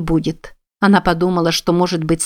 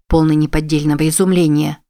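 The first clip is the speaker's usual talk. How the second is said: ordinary delivery, nothing marked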